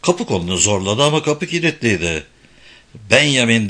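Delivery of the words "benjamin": ordinary delivery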